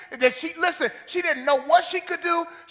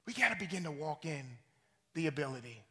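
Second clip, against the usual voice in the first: softer repeat; 11 semitones lower, 13 dB quieter than usual. Shown as a demonstration. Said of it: "repeats line softer".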